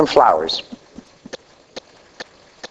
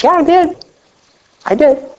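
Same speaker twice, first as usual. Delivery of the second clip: high-pitched insistent yes; matching her disbelief with a high, sure answer; emphatic retold reply; 9 semitones higher than usual. Husband assuring his amazed wife, emphatic. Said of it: "I says".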